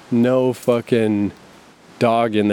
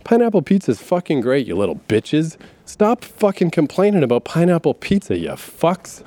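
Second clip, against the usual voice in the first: acting out an annoyed rant, pitched high; mock-furious tirade, voice jumping up; comic exaggerated irritation, higher than usual; annoyed outburst; 6 semitones higher than usual. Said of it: "I felt myself getting annoyed".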